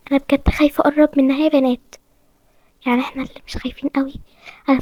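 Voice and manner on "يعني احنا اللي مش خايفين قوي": dry whispered aside; hushed ironic aside; sarcastic whisper. Acted. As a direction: whisper a wry remark. Whispering about the scary new girl.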